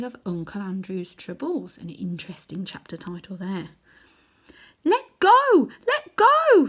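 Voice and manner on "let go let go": put-on high, loud panicked girl's scream. A narrator's reading. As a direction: shriek it as the girl, desperate to be let go. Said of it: "screamed Polly".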